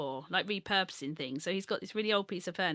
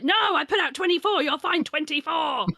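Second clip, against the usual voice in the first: loud, high mock insistence; imitated insistent shout; 8 semitones higher, 8 dB louder than usual. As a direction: imitate a husband calling out stubbornly. Retelling husband insisting during egg hunt.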